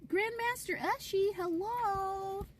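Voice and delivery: high, bright hello; cheerful greeting. Real, not acted. Welcoming a viewer to the stream, a high cheerful greeting. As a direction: greet them with delight.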